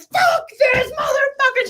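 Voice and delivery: high, slow, shouted outburst; Christy storming out of the intervention, in a high mock scream; retold scream. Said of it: "she's just, like, screaming".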